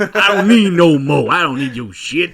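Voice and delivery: loud, drawn-out mock wail; hammed-up outburst. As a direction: belt it out high for laughs. Mock-belting a no-more line amid laughter.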